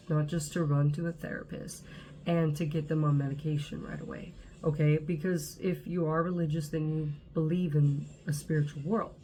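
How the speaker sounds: ordinary talk, nothing marked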